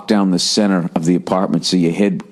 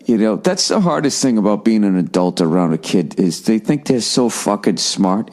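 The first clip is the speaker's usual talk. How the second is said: ordinary delivery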